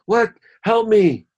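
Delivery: high, loud holler for help; screamed plea. Acted. Hanging man shouts up to God for help, screamed plea. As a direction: shout up in disbelief, then plead. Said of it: "he looks up and he screams".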